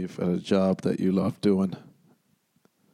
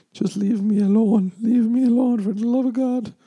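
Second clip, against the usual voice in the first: much higher, whiny begging to be left alone; high whiny leave me alone; exasperated plea; 13 semitones higher, 4 dB louder than usual. Acted. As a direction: plead in mock despair, pitched way up. Exasperated plea to online troll.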